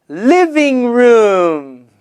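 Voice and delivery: slow, drawn-out living room; slow model word. Shown as a demonstration. Teacher to class, model word slowly. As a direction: stretch each syllable for the class. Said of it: "So everybody say".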